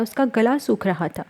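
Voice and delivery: ordinary delivery, nothing marked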